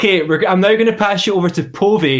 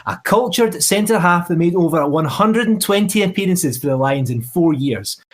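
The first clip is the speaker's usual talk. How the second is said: ordinary delivery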